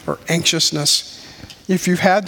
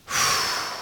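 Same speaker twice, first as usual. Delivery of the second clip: long unvoiced sigh, softer; heavy sigh; 7 dB quieter than usual. Real, not acted. Preacher sighs after recalling the surgeon's warning, heavy sigh. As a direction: let the breath out under the news.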